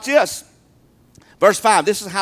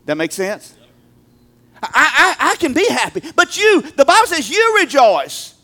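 ordinary talk, nothing marked

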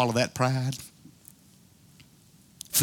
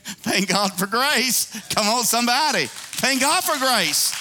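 rousing call; 8 semitones higher than usual. Real, not acted. Preacher rousing congregation.

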